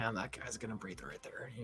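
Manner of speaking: very quiet, low grumbled impression; deadpan football coach muttering to himself; Bill Belichick. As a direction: mumble it low, almost under the breath. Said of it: "That was my best Bill Belichick impression".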